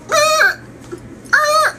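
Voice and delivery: short, very high bursts; imitated veering noise. Truck veering off the road, high startled noises. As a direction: yelp out two sharp noises.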